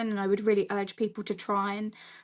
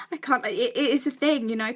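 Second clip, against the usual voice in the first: usual speaking voice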